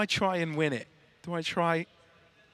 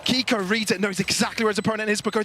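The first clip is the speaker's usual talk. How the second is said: higher, shouted caster call; excited play-by-play; 9 semitones higher than usual. Acted. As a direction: call the clutch live. Live play-by-play.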